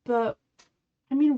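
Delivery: ordinary talk, nothing marked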